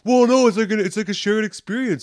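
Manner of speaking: high, loud, pleading explanation; earnest boyfriend. Retold or imitated. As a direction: justify it sweetly to her. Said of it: "He's like".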